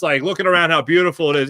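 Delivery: usual speaking voice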